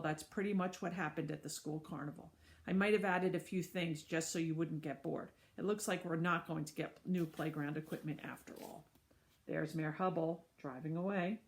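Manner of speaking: ordinary delivery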